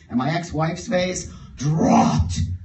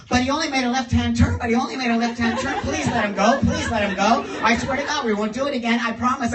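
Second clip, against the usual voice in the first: fast, higher, rushed begging; frantic pleading; 6 semitones higher, 4 dB louder, faster than usual. Retold or imitated. Friends in the car begging the cop to let him go. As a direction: beg the cop all at once.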